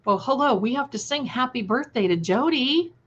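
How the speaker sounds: ordinary talk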